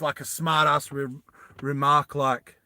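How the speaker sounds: ordinary talk, nothing marked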